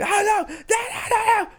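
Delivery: high, loud mock panic; mock screaming. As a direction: shriek the no's in terror. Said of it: "what I think's so funny is he's like".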